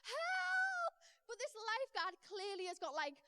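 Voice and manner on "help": high, drawn-out mock cry for help; louder, high-pitched playful distress call; retold shout for help. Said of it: "I look up to shout for help".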